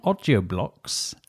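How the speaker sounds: slow put-on ad accent; special Audio Blocks accent. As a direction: draw out the brand name. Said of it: "my special Audio Blocks accent".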